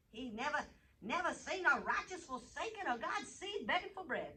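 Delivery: ordinary delivery, nothing marked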